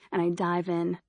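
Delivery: plain reading voice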